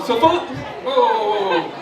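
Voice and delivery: slurred tipsy speech; words slurring together; slurring drunk. Pretend. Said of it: "I'm slurring my words now".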